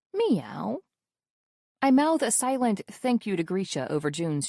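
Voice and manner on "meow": cat meow. Acted. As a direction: mimic a cat in a carrier. Cat meows from carrier.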